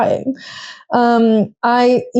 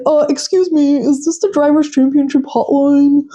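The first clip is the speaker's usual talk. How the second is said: a touch higher, cocky frat-bro phone greeting; 5 semitones higher than usual. Retold or imitated. Zach calling Lando on the radio, mock frat bro. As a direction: ham up the dude-bro caller. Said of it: "the most frat bro energy".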